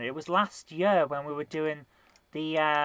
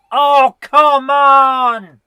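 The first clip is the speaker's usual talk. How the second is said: drawn-out loud howl; frustrated yell; 9 semitones higher, 17 dB louder, much slower than usual. Real, not acted. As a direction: wail at the game. Losing in game.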